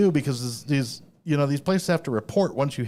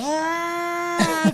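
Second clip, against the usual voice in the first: high hammed-up yelp; cartoon scream of a bee victim; mock scream. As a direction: yelp like a man swarmed by bees. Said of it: "He's like".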